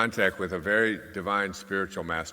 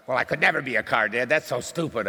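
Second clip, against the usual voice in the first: put-on fast, higher, louder kid scoff; scoffing child; 6 semitones higher, 4 dB louder than usual. Daughter scoffing at dad's car question, fast high childish scorn. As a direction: brush it off as a silly idea, like a kid.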